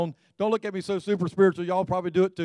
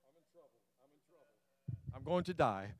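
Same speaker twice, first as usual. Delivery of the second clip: scared hush; 3 semitones lower, 11 dB quieter than usual. Retold or imitated. Preacher retells facing Mister T, hushed mock fear. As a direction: whisper your doom under your breath. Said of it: "I said".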